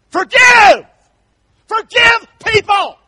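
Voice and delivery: high, full-voiced shout of forgive; preaching shout. Real, not acted. Preacher shouting the command to his congregation, loud. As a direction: bellow it at the pews.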